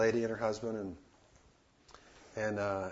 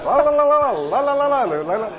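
high, loud singsong babble; mock babble; 14 semitones higher, 14 dB louder than usual. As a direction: imitate rapid foreign chatter as nonsense. Mimicking flurry of Russian talk.

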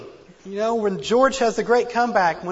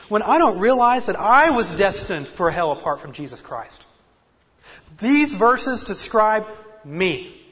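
preaching; 7 dB louder than usual. Acted. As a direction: preach it out with full force. Preaching at full force.

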